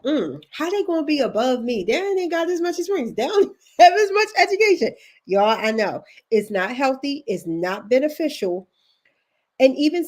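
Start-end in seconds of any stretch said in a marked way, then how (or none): 0.0-4.9 higher-pitched, indignant comparing voice; playfully put-on resentment; mock envious grumbling. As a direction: act out a jealous complaint, half laughing. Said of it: "it's so easy to be like".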